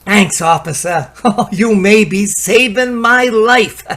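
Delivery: loud, laughing gratitude, slowed; relieved laughing thanks. Acted. Relieved crook thanks the cop.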